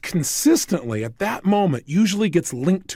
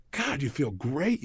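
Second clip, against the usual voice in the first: content sigh; 4 semitones lower, 8 dB quieter, slower than usual. Pretend. Imagining a great day.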